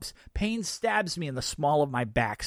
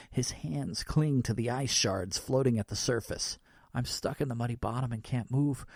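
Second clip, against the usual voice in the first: ordinary delivery, nothing marked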